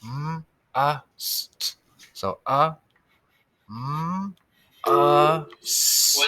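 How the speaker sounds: "M", "Uh", "St" split into separate sounds; sounding out. Shown as a demonstration. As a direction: break the word into its sounds. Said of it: "uh sound".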